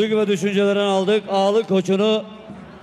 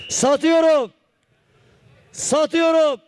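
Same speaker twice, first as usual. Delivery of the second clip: auctioneer; 8 semitones higher, slower than usual. Real, not acted. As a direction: call it out like a live auction. Auctioneer going twice.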